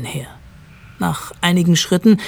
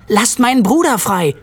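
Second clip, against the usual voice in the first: high, moderately raised cry; narrated shout; 7 semitones higher, 4 dB louder than usual. A narrator's reading. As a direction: demand it with a restrained yell. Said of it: "schrie Mallory". (gemäßigter, hoher Schrei)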